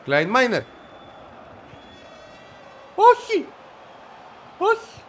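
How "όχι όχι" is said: high, rising cries of no; desperate no. Real, not acted. Gamer crying no as the opponent attacks. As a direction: plead with the ball not to go in.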